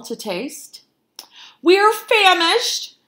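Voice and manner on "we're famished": high, loud, drawn-out howl. A narrator's reading. Hungry foxes complaining to the cook, howling loudly. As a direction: howl it out slowly. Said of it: "they howled".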